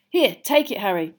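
plain reading voice

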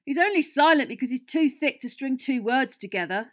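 impatient. A narrator's reading. Fred cutting off the giggling girls, impatient. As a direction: dismiss it impatiently. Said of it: "said Fred impatiently".

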